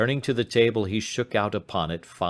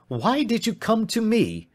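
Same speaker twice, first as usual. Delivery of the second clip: high-pitched reproachful question; exasperated cry; 3 dB louder than usual. Acted. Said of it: "he cried".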